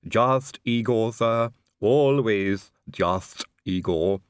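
slow, calm, lisping servant voice; calm Igor. A narrator's reading. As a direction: say it slowly and calmly, lisping. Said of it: "said Igor calmly".

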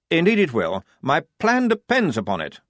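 a touch of pride; pleased officer's boast; proudly. Acted. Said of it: "said Blouse proudly".

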